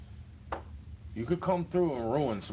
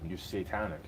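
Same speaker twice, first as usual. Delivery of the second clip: ordinary delivery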